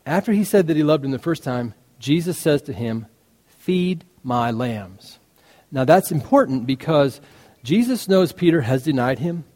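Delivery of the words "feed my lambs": slow, soft gentle charge. Retold or imitated. Jesus gently giving Peter his task, slow and soft. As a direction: lay the words down slowly and softly.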